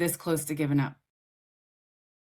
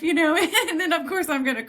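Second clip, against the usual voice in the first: laughing; 11 semitones higher, 4 dB louder, faster than usual. Real, not acted. Laughing at own story.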